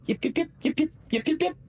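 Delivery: high-pitched fox yips at the imp; fox yipping. Acted. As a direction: yap like an excited little fox. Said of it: "or to the, the party".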